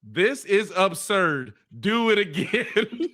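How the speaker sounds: slow, disapproving teacher voice; a hint of a fed-up teacher, drawn out; stern teacher note. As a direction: read the note like an unimpressed teacher. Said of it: "I got".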